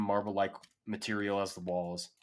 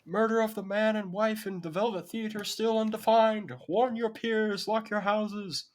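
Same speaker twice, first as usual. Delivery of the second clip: shouting town crier; 13 semitones higher, 3 dB louder than usual. Acted. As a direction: cry the news down the street. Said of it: "you hear town criers shouting".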